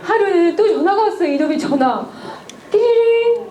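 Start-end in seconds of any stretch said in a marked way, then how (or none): 2.7-3.5 phone ring imitation. Phone rings again.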